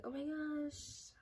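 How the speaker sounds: high, slow, drawn-out gasp of relief; surprised relief. Retold or imitated. Spotting her roommates at the airport, high drawn-out relief. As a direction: stretch it out as if amazed.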